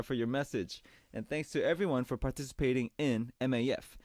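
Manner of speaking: ordinary delivery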